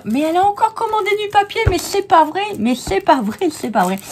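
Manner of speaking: high, fast mock outrage, fading down; mock exasperated viewer. Crafter mimicking her viewers' exasperated reaction, high-pitched. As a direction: playfully voice the viewers' disbelief. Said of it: "vous allez me dire".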